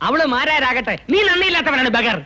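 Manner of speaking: high-pitched, irritated outburst; heated. Acted. Man calling his partner ungrateful, heated. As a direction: flare up at a companion, pitch jumping. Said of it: "എന്നോട് എന്തിനാ ചൂടാവുന്നത്?".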